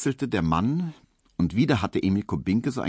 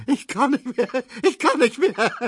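very high squeal, choked with laughing; helpless laughter; 4 dB louder than usual. A narrator's reading. Neumann overcome by the joke, helplessly laughing. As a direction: cry out, unable to stop laughing. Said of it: "Neumann lachte, dass die Scheiben zitterten".

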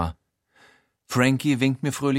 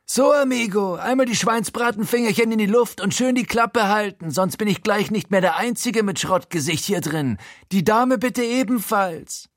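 Frankie; 12 semitones higher than usual. A narrator's reading. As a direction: order hostages around in a high, swaggering voice. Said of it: "die unverkennbare, fertige Frankie-Stimme". (hohe, großspurige Gaunerstimme)

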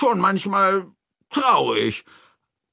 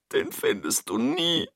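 small, softer sniffly voice; sniffling; 2 semitones higher, 5 dB quieter than usual. Acted. Toni doubts the thief can be found, sniffling softly. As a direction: sniffle it hopelessly. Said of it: "schnieft Toni".

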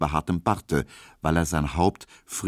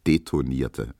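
usual speaking voice

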